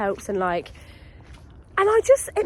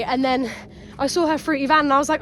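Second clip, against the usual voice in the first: normal talking voice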